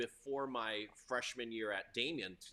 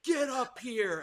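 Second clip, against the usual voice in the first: high, raised voice imitating a teacher; retold scream; 10 semitones higher, 5 dB louder, slower than usual. Teacher calling a boy up front, retold yell. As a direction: order it loudly, as the retold teacher. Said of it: "he just screams. He goes".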